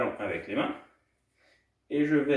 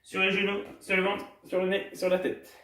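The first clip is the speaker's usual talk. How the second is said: quicker, higher-pitched body-part list; faster; 6 semitones higher than usual. Shown as a demonstration. Coach speeding up a children's exercise, faster. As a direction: pick up the speed through the list. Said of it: "Maintenant, on va plus vite encore".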